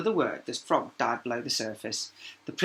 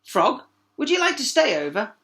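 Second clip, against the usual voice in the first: higher, louder parent; 10 semitones higher, 8 dB louder than usual. A narrator's reading. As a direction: invite kindly. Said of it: "her parents called".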